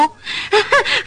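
rising tearful gasp; a sob that catches into a laugh; startled tearful gasp; half-laugh half-sob. Acted. Mother startled, half crying.

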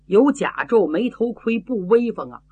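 usual speaking voice

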